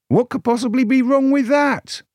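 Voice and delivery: high, outraged rhetorical question; indignant. A narrator's reading. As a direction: shriek it in mock outrage.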